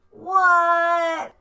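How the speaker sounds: high loud shout; cried out. A narrator's reading. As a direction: answer loudly from another room. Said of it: "cried Froggy".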